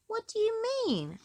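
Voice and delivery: puzzled little pig; high Peppa voice. A narrator's reading. Peppa puzzled by Daddy Pig.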